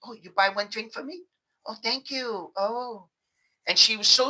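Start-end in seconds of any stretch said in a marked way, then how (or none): 0.0-3.1 higher and softer, coy thank-you; lightly voiced sweet bar girl; sweet grateful girl. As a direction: coo it like a girl charming a guy into a drink. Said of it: "Then it's like".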